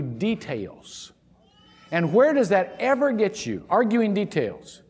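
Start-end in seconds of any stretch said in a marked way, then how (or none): none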